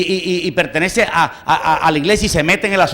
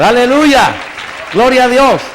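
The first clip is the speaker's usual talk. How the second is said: higher, loud jubilant shout; loud praise shout; 6 semitones higher, 6 dB louder, slower than usual. Real, not acted. Preacher exults before the congregation, loud praise.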